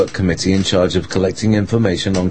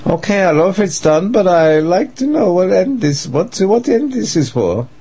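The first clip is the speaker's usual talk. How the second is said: agitated high voice; 12 semitones higher than usual. Acted. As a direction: press for answers, rattled. Dietrich argues on phone.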